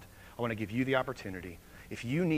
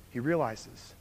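usual speaking voice

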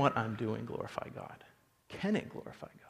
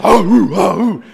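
loud, high war whoops; army war cry; 8 semitones higher, 17 dB louder than usual. Acted. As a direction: whoop like soldiers answering their king. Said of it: "they give out this".

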